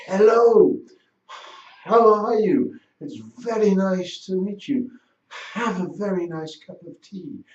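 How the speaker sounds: high, hypercorrect RP with a huge breath on the H of "Hello", "how" and "Have"; overemphasised H. Shown as a demonstration. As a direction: puff out every H in "Hello", "how", "Have", affectedly slow. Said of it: "would see someone overemphasize".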